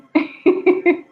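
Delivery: short high burst of laughter. Real, not acted. Laughing at the audience who can't raise hands. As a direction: laugh at your own joke.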